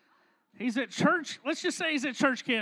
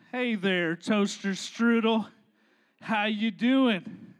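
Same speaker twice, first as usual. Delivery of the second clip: low, slow phone greeting; laid-back boyfriend, drawn-out words; smooth boyfriend; 5 semitones lower, slower than usual. Acted. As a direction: play it cool and drawl to your girl. Plays boyfriend answering the phone.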